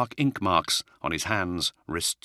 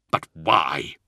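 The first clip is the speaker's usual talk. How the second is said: disbelieving; 3 dB louder than usual. Acted. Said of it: "he said disbelievingly".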